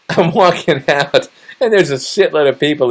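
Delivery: laughing through the words. Real, not acted. Retelling leaving the store, laughing while talking. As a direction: giggle out the line.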